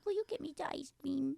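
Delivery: put-on high, soft babyish pleading; baby talk. Woman asking boyfriend for a treat in baby talk. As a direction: wheedle in a little-girl voice. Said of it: "occasional baby talk when you say to your boyfriend".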